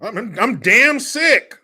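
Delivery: loud, high, slow mock outrage; Newsom. Host mimicking the fed-up governor, loud drawn-out mockery. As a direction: stretch it out in a high, loud parody voice. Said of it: "I meant to be talking like Newsom".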